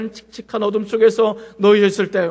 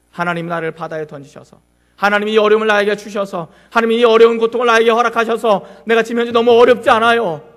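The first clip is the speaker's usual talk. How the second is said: arguing with God; 3 semitones higher, 7 dB louder than usual. Acted. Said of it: "라고 따지는 그런 경우".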